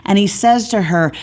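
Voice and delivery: ordinary talk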